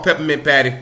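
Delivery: ordinary delivery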